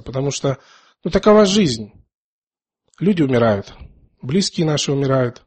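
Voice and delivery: ordinary talk, nothing marked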